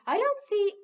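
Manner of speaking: sharp, high and loud puzzlement; puzzled. Acted. King baffled by the head wizard, high and loud. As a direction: cut in, clearly baffled.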